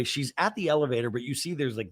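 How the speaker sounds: ordinary delivery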